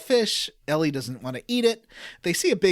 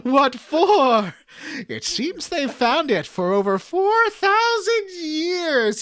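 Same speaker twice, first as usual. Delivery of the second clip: higher, theatrical cutscene voices, breaking into laughs; hammed-up game dialogue; 7 semitones higher, 4 dB louder, slower than usual. Reading game script aloud to co-hosts, hammed-up dramatic voices. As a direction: overact the villains' lines while trying not to laugh.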